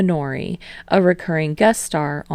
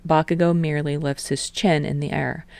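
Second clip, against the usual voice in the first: ordinary delivery, nothing marked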